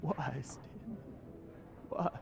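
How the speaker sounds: soft, broken-hearted question; grieving. Acted. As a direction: ask the dead, voice falling away. Mourns dead partner.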